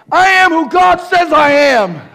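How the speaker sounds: high, loud cry on the run; shouted declaration. Shown as a demonstration. Preacher crying the words as if running. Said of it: "while you're running".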